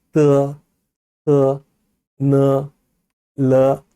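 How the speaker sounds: 뜨, 뜨, 느, 르 held long and level; tongue-tip sounds. Shown as a demonstration. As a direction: tap 뜨, 뜨, 느, 르 off the tongue tip, each drawn out. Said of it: "혀 끝소리입니다".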